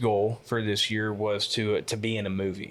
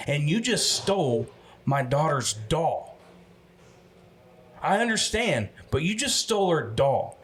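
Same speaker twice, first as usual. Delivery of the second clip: confronting a doll thief; 5 semitones higher than usual. Acted. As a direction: scold the grown man who stole the doll. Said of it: "I'll turn actor mode".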